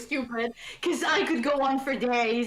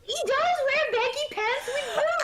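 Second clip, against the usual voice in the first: excited shout; 13 semitones higher than usual. Real, not acted. Laughing agreement shout.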